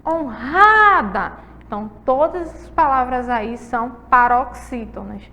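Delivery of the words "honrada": honrada with the middle syllable RA stretched long and loud; stress on second-to-last syllable. Shown as a demonstration. Teacher showing a paroxytone to pupils, stressed middle syllable. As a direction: lean hard on the RA of honrada. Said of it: "penúltima sílaba delas é a mais forte".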